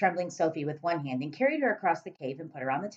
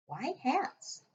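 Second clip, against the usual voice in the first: put-on little girl voice; 9 semitones higher, 6 dB quieter than usual. Sophie's voice.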